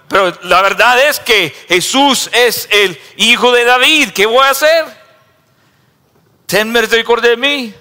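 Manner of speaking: high, loud pleading shout; fervent loud plea; impassioned cry. Acted. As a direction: cry out for mercy. Preacher cries out as Bartimaeus.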